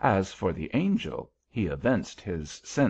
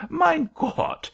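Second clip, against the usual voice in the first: put-on very high, louder German-accented wail; distressed German-accented angel; 5 dB louder than usual. A narrator's reading. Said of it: "apparently much softened at my distress".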